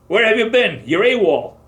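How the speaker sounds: first sergeant yells at the late new arrival, loud and high; sergeant yelling. Retold or imitated. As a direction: bawl him out for going AWOL. Sergeant scolding late soldier.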